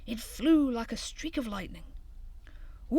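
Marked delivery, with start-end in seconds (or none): 2.9-3.0 whoop. A narrator's reading. Sledging down the hill.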